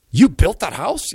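high, slow amazed question. Retold or imitated. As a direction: marvel at the house. Amazed question about house.

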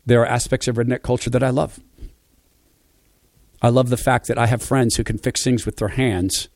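ordinary delivery

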